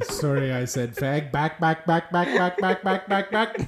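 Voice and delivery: loud sportscaster's rhythmic home-run call; Chris Berman. Acted. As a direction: go from aside into a rising, punched chant. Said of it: "It's Chris Berman".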